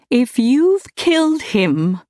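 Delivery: high, loud, slow fierce threat. A narrator's reading. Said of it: "she said fiercely".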